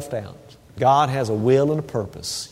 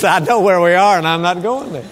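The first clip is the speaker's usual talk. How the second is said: stubborn dog voice; 5 semitones higher, 7 dB louder than usual. Pretend. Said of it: "She says".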